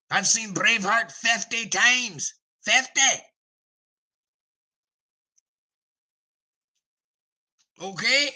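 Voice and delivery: loud, high angry Scotsman scolding a know-nothing; Scottish accent. Retold or imitated. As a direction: bellow it like an outraged Scot who has seen Braveheart. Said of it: "Sepson says".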